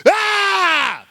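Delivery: very high, long release yell; top-of-lungs yell. Retold or imitated. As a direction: blow it all out in one yell. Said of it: "just top of my lungs".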